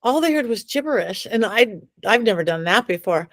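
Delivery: ordinary talk, nothing marked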